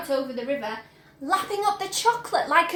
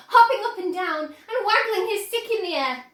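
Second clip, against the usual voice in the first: plain reading voice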